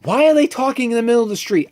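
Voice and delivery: a viewer baffled by a scene in the street; exasperated disbelief. Retold or imitated. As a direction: throw your hands up at the screen. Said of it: "I'm just like".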